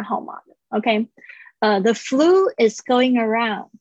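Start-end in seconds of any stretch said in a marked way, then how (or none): 1.8-3.7 slow model reading, full "ah-oo" in "around"; careful pronunciation model. Shown as a demonstration. Teacher models the flu sentence for students, careful pronunciation. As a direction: say it slowly, rounding out "around". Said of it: "注意一下这个O U的声音是啊呜".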